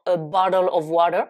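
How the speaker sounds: "a bottle of water" in an American accent, the T flapped to a D. Shown as a demonstration. Teacher showing how North Americans say it. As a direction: say "a bottle of water" as "a baddle of wader". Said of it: "les Nord-Américains vont dire".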